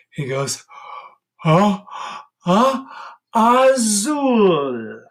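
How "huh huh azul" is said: high loud sneezed azul; sneeze azul. Shown as a demonstration. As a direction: sneeze the word out like a dog. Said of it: "When the blue dog sneezes".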